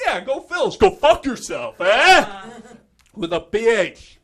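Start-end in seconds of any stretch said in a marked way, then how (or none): 0.1-2.5 loud, slow, hammed-up Philly sneer; loud, slow Philadelphia accent ending in a questioning eh; mock Philly accent. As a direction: tell them off in a thick Philly accent. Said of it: "We're like".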